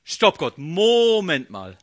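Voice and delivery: loud, slow halt; loud protest. Retold or imitated. A believer loudly interrupting God, slow protest. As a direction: stop God in his tracks.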